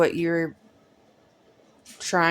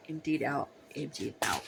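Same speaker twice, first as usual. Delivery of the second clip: fading echo; 3 semitones lower, 9 dB quieter than usual. Shown as a demonstration. Echoing, fading repeat.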